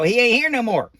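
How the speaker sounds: lightly voiced folksy Billy Bob; the hillbilly version of the line; Billy Bob drawl. Shown as a demonstration. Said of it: "I'm Billy Bob. I go".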